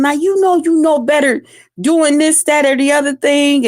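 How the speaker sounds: mild mock yell, high-pitched; retold scolding yell. Aunt tells off her smaller nieces, mildly retold yelling. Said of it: "yell at them".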